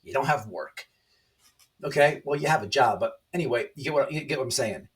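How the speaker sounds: ordinary delivery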